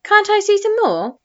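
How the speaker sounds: high pleading protest. A narrator's reading. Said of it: "protested George".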